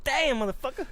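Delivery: hammed-up deer cursing; cartoon deer yelp. Deer voiced after being shot.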